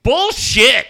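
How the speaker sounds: loud, high shout of disbelief; blurted shout. Retold or imitated. Reporter shouting at the announced attendance from the press box. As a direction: yell it out without missing a beat. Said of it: "turns and goes".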